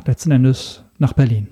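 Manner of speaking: ordinary talk, nothing marked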